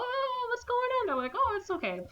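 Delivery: very high, soft mock-airy voices; airy passengers. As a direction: float it up like clueless partygoers.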